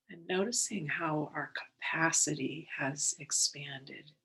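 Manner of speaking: unhurried, calm and measured, settling them in; guided meditation. Real, not acted. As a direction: guide listeners gently into the breath, leaving space between phrases.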